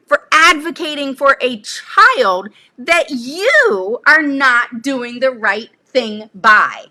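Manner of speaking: indignant inner outburst. Retold or imitated. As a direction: draw it out, offended and defiant. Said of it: "in my mind, I'm thinking".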